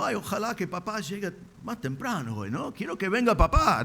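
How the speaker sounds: high-pitched, swooping fake longing; mock eagerness. Man mimicking himself as a boy wishing papá home, mock eager. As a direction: play a child pretending to be eager.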